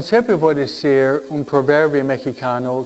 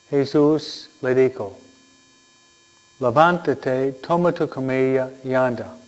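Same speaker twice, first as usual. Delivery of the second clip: Gospel reading. Acted. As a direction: read it like scripture at Mass.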